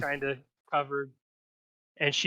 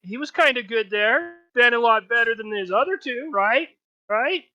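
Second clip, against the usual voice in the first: high, loud patter to the crowd; raised, pitched-up host voice; game-show host; 7 semitones higher, 6 dB louder than usual. Acted. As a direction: work the crowd as an announcer. Half-elf host addresses the crowd.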